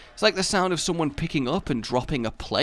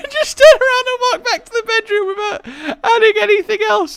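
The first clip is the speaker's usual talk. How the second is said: very high, loud, laughing as they talk; real giggles squeezing the voice high; helpless laughter; 9 dB louder than usual. Real, not acted. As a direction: barely get it out for laughing. Laughing at own exit.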